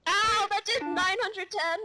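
high, loud game yelp; pained outcry. Real, not acted. Player hit for nine hundred ten, high loud outcry. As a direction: cry out high at the damage. Reacting to big damage in game.

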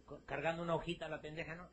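normal talking voice

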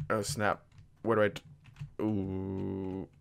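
ordinary talk

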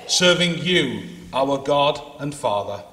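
ordinary talk

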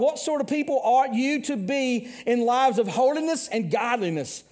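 high, loud preacher climb; fiery preaching. Acted. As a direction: build it up like a revival pulpit. Preaching at full force.

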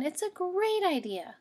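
put-on high, bright pleading kid voice; child rabbit backing up the plan; eager child. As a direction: insist like a hopeful little bunny.

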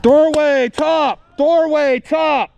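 loud high repeated callout; high shout. Real, not acted. Calling enemy position.

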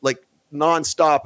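usual speaking voice

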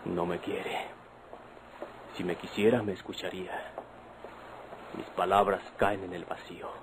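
a wounded murmur fading into a whisper; hurt muttering to himself. Acted. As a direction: brood aloud after she has gone. Alone after she walks out.

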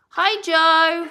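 high, loud hello; called greeting. Real, not acted. Owner greets Joe during bird chat, high loud call.

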